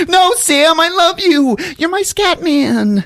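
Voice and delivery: high, loud mock-tender woman; sweetly begging, hammed-up; pleading Lisa. Lisa pleading her love to Sam, hammed-up.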